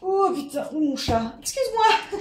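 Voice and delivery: aigu, surprise puis rire; s'excuser au chat en riant; sursaut surpris. Real, not acted. Se cogne au chat. (surprise amusée)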